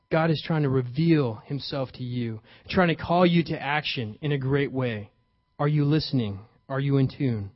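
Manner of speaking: ordinary delivery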